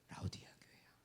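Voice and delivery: breathy hushed reveal; whispered to the congregation. Acted. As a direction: whisper it like a secret. Whispered revelation.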